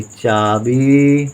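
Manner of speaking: very slow, stretched out; drawn out syllables. Shown as a demonstration. Teacher dictating a Hindi word to pupils, drawn out slowly. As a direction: sound it out slowly for the class. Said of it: "teacher sounds out word".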